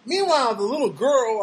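normal talking voice

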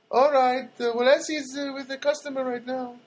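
high-pitched mock accent; imitated thick-accented phone agent; Luna, thick accent. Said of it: "very thick accent".

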